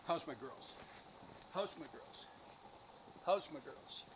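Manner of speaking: quiet, fond greeting to the dogs; soft doting greeting to his dogs; soft pet talk. Real, not acted.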